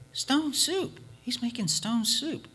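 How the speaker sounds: put-on high, bright-eyed child's voice; little girl voice. Little girl telling her mother about the soup, high and excited. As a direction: pitch it up like an eager little girl.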